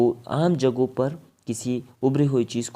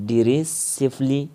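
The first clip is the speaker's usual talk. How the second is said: slow pronunciation; 3 semitones higher, 4 dB louder, much slower than usual. Shown as a demonstration. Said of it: "teacher pronounces headword".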